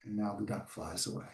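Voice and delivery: low and hushed; soft storybook ending. A narrator's reading. Teacher reading to children, hushed ending. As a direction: settle the last line gently.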